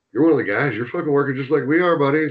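put-on loud, fast ribbing among the boys; mock shout. Telling John he works just like the boys, loud and fast. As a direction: bark it at him like a teammate.

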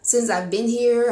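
ordinary talk, nothing marked

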